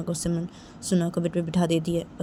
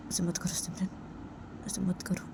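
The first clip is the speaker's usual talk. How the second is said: soft pleading; 8 dB quieter than usual. Acted. Pleads with friend to stop.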